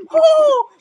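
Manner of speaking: very high mock squeal; imitated squeal. Storyteller showing the gym guy's second sit-up, high squealing moan. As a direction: let out a high strained moan on the rep. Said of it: "he's screaming and squealing".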